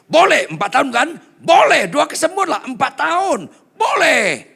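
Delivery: loud, high shouted answer; teriak keras. Retold or imitated. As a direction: shout the yes out eagerly. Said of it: "Langsung saya teriak keras". (teriakan keras bersemangat)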